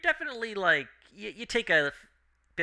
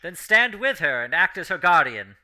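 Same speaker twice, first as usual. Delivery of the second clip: louder, firm noble command; commanding knight; 5 semitones higher, 11 dB louder than usual. Acted. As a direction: order it like a righteous knight. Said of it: "Val does speak to you".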